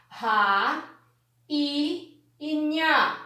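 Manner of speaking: RA, I, NHA drawn out separately; syllable by syllable. Shown as a demonstration. Teacher separating rainha for pupils, slow syllables. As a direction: slowly sound out each part of rainha. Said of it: "como foi que eu separei".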